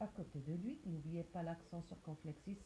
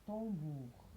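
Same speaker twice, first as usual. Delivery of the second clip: ordinary talk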